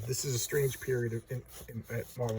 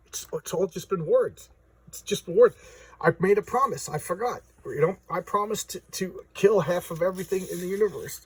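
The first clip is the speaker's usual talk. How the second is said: imitated realization, raised and insistent; mock self-reproach, pitched up; dawning realization; 5 semitones higher, 4 dB louder than usual. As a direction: act out someone suddenly remembering a promise. Thanos suddenly remembers his promise.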